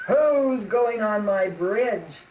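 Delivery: loud, slow troll roar; drawn-out, booming challenge; menacing troll. Acted. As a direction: bellow it like an angry troll. Troll challenges middle goat.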